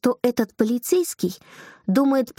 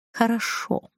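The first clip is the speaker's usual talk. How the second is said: clipped-back, understated assent; restrained reply; 3 dB quieter, slower than usual. A narrator's reading. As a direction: agree with reserve, giving nothing away. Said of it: "сдержанно ответил официант". (сдержанное согласие)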